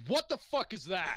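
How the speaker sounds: loud, high-pitched shock; alarmed outburst. Acted. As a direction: recoil in alarm and demand an answer. Paladin recoils from the book.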